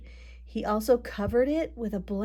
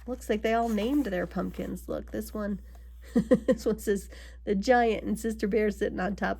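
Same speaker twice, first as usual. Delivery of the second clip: usual speaking voice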